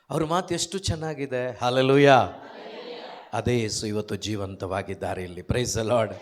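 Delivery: normal talking voice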